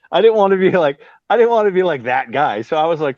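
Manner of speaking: ordinary talk, nothing marked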